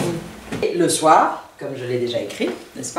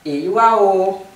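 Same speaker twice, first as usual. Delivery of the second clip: EYUAO climbing into a long held ending; drawn-out EYUAO with a lifted tail; rising at the end; 3 dB louder than usual. Shown as a demonstration. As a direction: raise and stretch the end of EYUAO. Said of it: "ça monte toujours en tonalité à la fin".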